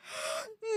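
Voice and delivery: high thin gasp for air; mock wheezing gasp. Reader fakes a sick friend's wheezing gasp. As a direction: gasp like a sick man short of breath.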